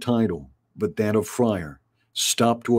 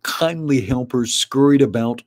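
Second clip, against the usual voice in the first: plain reading voice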